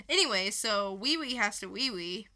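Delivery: ordinary delivery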